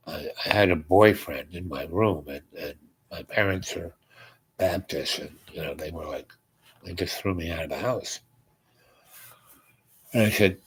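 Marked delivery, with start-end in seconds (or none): 0.1-8.2 low, soft, halting admission; retold subdued confession. Young stranger explains being thrown out, subdued low confession. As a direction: lightly act a reluctant confession, sinking quieter.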